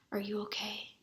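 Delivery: soft unvoiced whisper. A narrator's reading. Mother whispers to her son: hushed whisper. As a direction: breathe it into his ear. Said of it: "she whispered into his ear".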